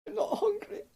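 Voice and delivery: squeaky character voice. Acted. Player's character quips.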